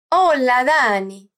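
Hola, Dani with a lilting fall; singsong intonation. Shown as a demonstration. Teacher imitating Belén's reply on the phone, singsong intonation. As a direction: sing out "Hola, Dani", sliding down on Dani.

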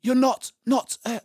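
put-on high, faltering girl's question; shaky voice. A narrator's reading. Susan cannot say the word ghost, shaky voice. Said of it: "asked Susan in a shaky voice".

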